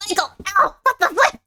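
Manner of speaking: high startled cry; shocked outburst. Real, not acted. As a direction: yelp it out in shock. Cat hit her jaw.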